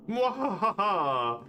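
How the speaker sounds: slow, high villain cackle; evil cackle. Pretend. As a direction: draw out a gloating evil laugh. Villain laugh, drawn out.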